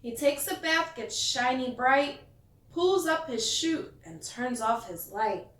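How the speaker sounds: lively picture-book read-aloud; storyteller reading to kids; reading to children. A narrator's reading. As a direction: read it like bedtime story time.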